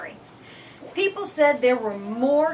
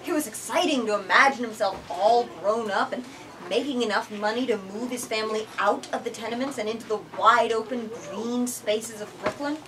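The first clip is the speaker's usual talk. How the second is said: usual speaking voice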